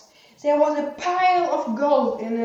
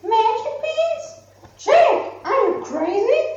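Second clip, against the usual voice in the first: high, loud outraged scolding; angry mother; 10 semitones higher, 3 dB louder than usual. Acted. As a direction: blow up at the boy. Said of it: "Jack's mother got very angry".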